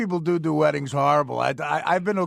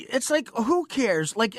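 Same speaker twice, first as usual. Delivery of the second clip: normal talking voice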